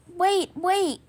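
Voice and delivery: the dolls calling wait. A narrator's reading. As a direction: call after Santa. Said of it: "called the other dolls".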